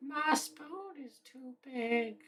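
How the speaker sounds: high, soft, drawn-out silly voice; silly put-on voice. Joking after the banana bit, silly high slow voice. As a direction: say it tiny and high, very slowly.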